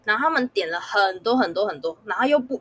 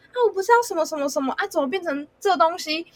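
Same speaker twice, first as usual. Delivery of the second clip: high, fast, irritated complaint; annoyed man; 7 semitones higher than usual. Retold or imitated. Male customer snapping about the wrong order, fast and irritated. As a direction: rattle it off like an impatient grumpy customer. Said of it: "男生就直接超不爽说".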